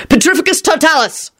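high, sudden cast; spell incantation. Acted. Stranger casting at the gunman, commanding incantation. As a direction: call out the spell sharply.

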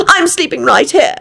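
high, insistent declaration; stubborn. A narrator's reading. Elizabeth settling beside Lupin's head, stubborn. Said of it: "she added stubbornly".

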